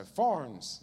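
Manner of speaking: THORNS with an effortful R, th still near f; attempted American pronunciation. Shown as a demonstration. British preacher correcting himself for Americans, THORNS with a laboured R. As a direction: try THORNS as "forrns". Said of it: "learning how to speak American".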